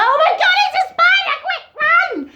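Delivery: hammed-up terror at a spider, half laughing; mock panic. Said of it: "you'll go".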